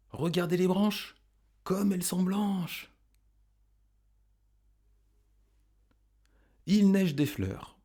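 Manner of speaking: high-pitched, line-by-line poem recitation; recitation for repeating. Shown as a demonstration. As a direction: lift each line high and leave room to repeat. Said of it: "vous pouvez répéter après moi".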